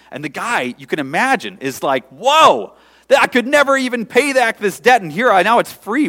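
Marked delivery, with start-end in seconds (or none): 2.1-6.1 high, loud astonished gasp of relief; the freed debtor can't believe it; stunned relief. Acted. Freed debtor to himself, high astonished relief.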